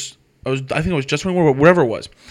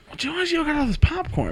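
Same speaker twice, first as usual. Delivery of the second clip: imitating puzzled self; 12 semitones higher, 6 dB quieter than usual. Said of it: "I'm like".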